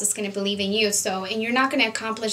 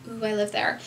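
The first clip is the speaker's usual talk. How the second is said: normal talking voice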